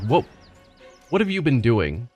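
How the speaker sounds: ordinary talk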